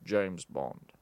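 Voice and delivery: low, quiet spy introduction; soft, deep, measured spy voice; Sean Connery. Acted. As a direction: say it low and understated. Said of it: "I'll do yours".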